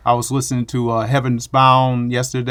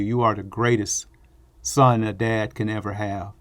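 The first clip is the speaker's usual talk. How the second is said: ordinary talk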